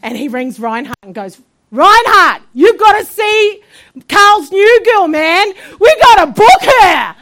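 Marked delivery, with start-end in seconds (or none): 1.7-7.2 loud, high excited shouting; excited urging. Retold or imitated. Man phones Reinhard about the new preacher, shouting excitedly. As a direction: holler the big news down the line.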